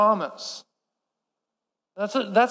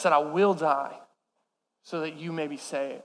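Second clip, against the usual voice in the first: hushed tender aside; 9 semitones lower, 8 dB quieter than usual. Real, not acted. Sermon's tender reflective aside.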